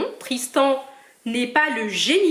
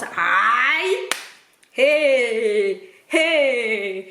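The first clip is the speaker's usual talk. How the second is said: rally the fans like a stadium crowd; hyped cheer; much slower than usual. Real, not acted. Cameroon team pride, hyping fans.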